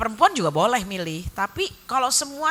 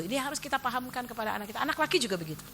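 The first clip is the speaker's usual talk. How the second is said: ordinary delivery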